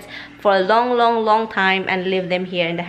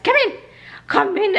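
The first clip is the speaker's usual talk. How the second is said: sharp, much higher frustrated call; frustrated loud call; 10 semitones higher than usual. Retold or imitated. Scolding the dog to come in, frustrated. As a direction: snap the command at the dog. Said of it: "My tone is, like, so loud".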